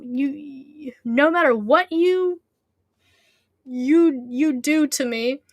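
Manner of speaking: slow, swooping theatrical defiance; defiant Herobrine. Acted. Herobrine refusing to bow to anyone, slow defiant declaration. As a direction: stretch the words out in grand defiance.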